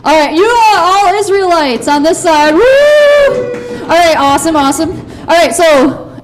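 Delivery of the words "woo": excited cheer. Real, not acted. Cheering Israelites.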